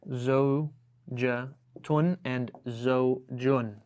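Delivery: زوجة tun and زوج in slow, separated syllables; word demonstration. Shown as a demonstration. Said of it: "So we have this word".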